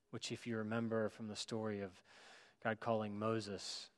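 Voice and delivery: normal talking voice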